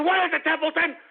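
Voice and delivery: high, strained yelling at a pet; rallying holler. Acted. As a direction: whip the pet into a political frenzy. Same holler, addressed to Templeton.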